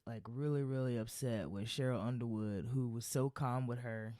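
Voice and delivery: ordinary talk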